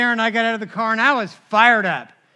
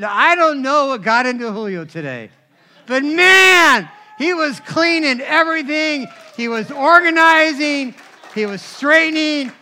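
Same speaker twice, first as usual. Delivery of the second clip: amazed wife; 5 semitones higher, 7 dB louder than usual. Retold or imitated. Michelle's amazed report retold.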